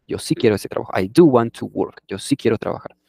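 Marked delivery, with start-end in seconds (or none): 1.0-2.0 "do" in "I do want to work" louder and emphatic; stress on DO. Shown as a demonstration. Answering a doubter, insisting firmly. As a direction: punch the "do". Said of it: "es un énfasis".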